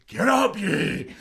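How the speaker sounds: very high, very loud bark at the fighter; corner yell. Retold or imitated. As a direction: scream him up off the canvas. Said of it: "he's like".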